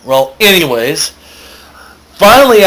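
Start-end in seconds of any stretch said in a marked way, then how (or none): 2.1-2.6 excited "finally". Real, not acted. Relieved to continue the story.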